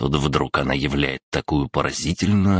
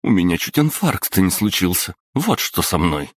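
higher, indignant mother telling off her son; a startled mum snapping back, higher; mother scolding her son after a fright, higher; 4 semitones higher than usual. Acted.

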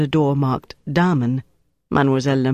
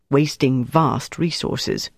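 plain reading voice

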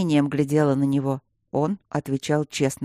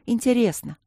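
light, higher voice, falling gently; young student's admission; 7 semitones higher than usual. A narrator's reading. Student admitting the exam was interesting, light high voice. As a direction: answer simply in a higher girlish voice. Student answers, then gets echoed.

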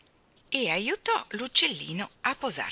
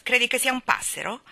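raised, teasing question; mocking; 6 semitones higher, 6 dB louder than usual. A narrator's reading. Mary Poppins ridicules the fledgling, mocking question. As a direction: tease with a louder, higher lilt. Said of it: "lo canzonò Mary Poppins".

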